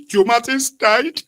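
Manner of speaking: high, slow mock-tragic wail; mock grief. Friend announcing a stranger's death, slow high mock wail. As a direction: stretch it out like fake mourning. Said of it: "He said".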